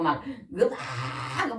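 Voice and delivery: laughing shout of pain; mock yelp. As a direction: yelp as if your side was grabbed. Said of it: "막 악을 쓰면서요".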